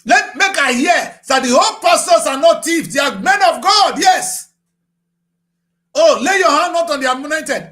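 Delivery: loud, high mock outrage voicing the pastors' defenders; scornful mock cry of the faithful; mocking shout. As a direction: shout their excuse back at them in scorn.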